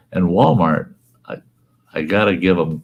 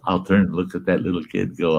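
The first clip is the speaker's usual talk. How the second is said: ordinary talk, nothing marked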